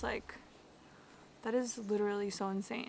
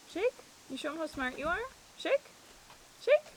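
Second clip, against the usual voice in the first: doggy voice; 7 semitones higher than usual. Real, not acted. Urging the dog to show off her shake trick. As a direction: cheer the dog on in a squeaky voice.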